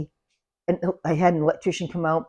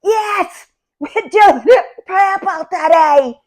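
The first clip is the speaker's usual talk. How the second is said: very high, drawn-out loud yell; big, high-pitched holler; yelling into her ear; 11 semitones higher, 8 dB louder, slower than usual. Retold or imitated. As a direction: holler slowly so a hard-of-hearing woman hears. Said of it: "I'm having to yell into her ear".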